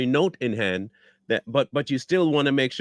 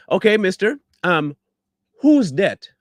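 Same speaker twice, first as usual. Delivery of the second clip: louder, higher sarcastic jab at him; sarcastic challenge; 5 semitones higher, 6 dB louder than usual. Real, not acted. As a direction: call him out mockingly. Challenging the man in clip.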